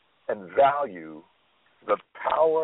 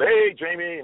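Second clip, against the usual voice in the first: light Oprah voice, a bit higher; Oprah impression; 8 semitones higher, slower than usual. Jamie doing Oprah calling his name, lightly voiced. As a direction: lightly voice Oprah's warm greeting. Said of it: "he did an Oprah impression".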